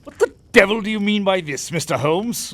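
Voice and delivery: loud, high, indignant demand; furious shout; angry outburst. Acted. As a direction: bellow it at him in outrage. Gibson furious at Holmes' insinuation.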